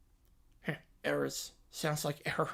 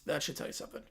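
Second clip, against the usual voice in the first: whispered aside, much softer; 6 semitones lower, 12 dB quieter, much faster than usual. Shown as a demonstration. Host repeating the point to listeners, whispered. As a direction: breathe it as a knowing aside.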